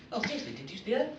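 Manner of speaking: high, fast, anxious insistence; spooked punk. Acted. Scared punk pleading with his partner, spooked. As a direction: rush it out, nervous and high.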